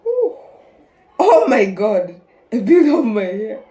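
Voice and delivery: loud laughing surprise; laughing shock. Real, not acted. Mask pulls out hair.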